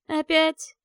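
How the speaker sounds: Опять grumbled with a weary rise; Опять as a put-upon sigh; grumbling. Acted. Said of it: "проворчал ... Морф".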